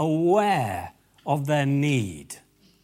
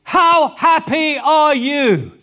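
loud and high, slow, wondering; joyful wow; 9 semitones higher, 9 dB louder, much slower than usual. Acted. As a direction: burst out with delighted amazement. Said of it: "What he meant was".

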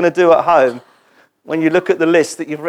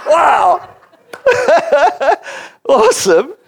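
high, laughing surprise; thrilled at the response; delighted; 11 semitones higher, 3 dB louder than usual. Real, not acted. Pastor thrilled by the congregation's passionate reply.

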